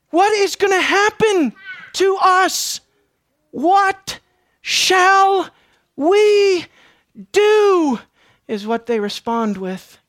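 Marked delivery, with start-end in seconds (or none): 0.1-8.0 higher, louder drawn-out plea; desperate crowd. Acted. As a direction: stretch each word of the plea. Crowd's desperate question voiced.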